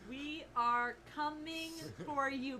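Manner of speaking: slow, word-by-word delivery; all-caps message. Acted. Player quoting an all-caps threat to the Khan, slow and emphatic. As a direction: spell it out slowly, every word weighted. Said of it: "all caps".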